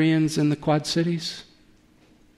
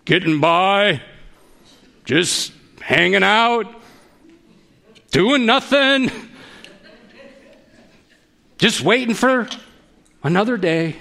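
modern-day Stoic; 2 semitones higher than usual. Acted. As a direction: stretch each phrase out, pausing long between them. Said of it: "Maybe a modern day Stoic".

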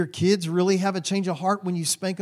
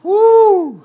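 high, loud whoop of relief; 14 dB louder than usual. Real, not acted. Preacher shaking off the memory for the congregation, loud whoop. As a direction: let out a big shaky woo.

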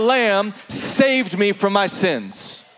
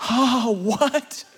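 amazed reader; 3 semitones higher than usual. Retold or imitated. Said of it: "you're like".

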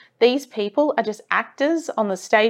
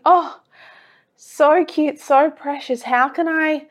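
put-on cooing at baby photo; 4 semitones higher, slower than usual. Cooing at her childhood photo.

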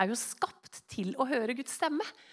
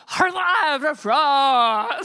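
loud laugh; 2 semitones higher, 10 dB louder than usual. Real, not acted. Laughing at memory.

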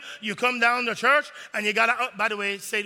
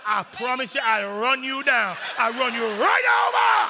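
mock threat; 3 dB louder than usual. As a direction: threaten to run him down, hammed up. Threatening parking lot dude.

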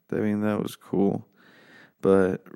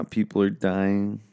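ordinary delivery

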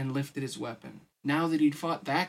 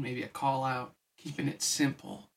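ordinary delivery

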